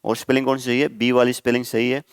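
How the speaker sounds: usual speaking voice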